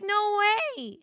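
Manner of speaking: high-pitched, disbelieving squeal; retold freak-out, high and emphatic. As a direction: re-enact stunned excitement, voice jumping up. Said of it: "freaking out".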